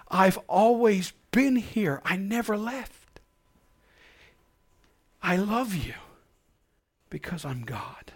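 soft, slow, fading to a whisper; tender reassurance. Acted. Preacher voices God reassuring the weary believer softly. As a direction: comfort the exhausted child gently. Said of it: "And his words are".

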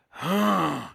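loud snorting horse huff. Retold or imitated. Preacher imitating Big John snorting at paper. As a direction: blast a horse's snort through the nose. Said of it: "snorted out his nostrils and went".

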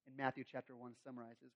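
ordinary talk

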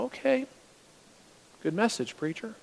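soft, offhand praise; brushing off the sermon quietly; mild mock dismissive listener. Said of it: "and go".

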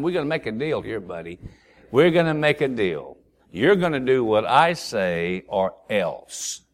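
low, softly voiced blackmailer; mock threat. Little brother Keith blackmails Ray, quiet mock menace. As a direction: lay down the deal, low and slowing.

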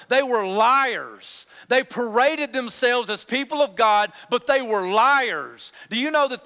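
loud, driving pulpit delivery; fiery sermon; full-force preaching. Acted. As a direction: preach it hard to the congregation.

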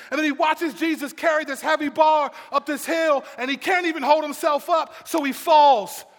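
high, loud, driving narration; impassioned pulpit shout; full-force preaching. Acted. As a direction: preach the story at mounting urgency.

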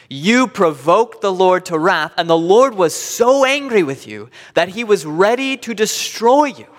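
loud, high, forceful preaching delivery; emphatic scripture reading. Acted. Preacher reads Moses' words emphatically.

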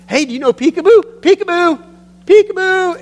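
put-on very high, loud baby-game voice; playful peekaboo. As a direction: sing out to delight a baby across the room. Man plays peekaboo with the baby.

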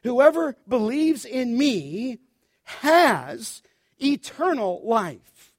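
slow, weighty reading of whoever believes; underline that phrase. Acted. Preacher telling the church to underline this, slow and weighty. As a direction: lean on every word of the promise. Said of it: "underline that phrase".

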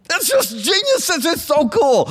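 very high squeal of delight; giddy excitement. Real, not acted. Preacher thrilled by Isaiah's wordplay, giddy. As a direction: geek out loudly, voice leaping way up.

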